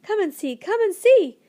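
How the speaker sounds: high, loud squeals of joy; excited screaming. A narrator's reading. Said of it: "The Jiffies were screaming".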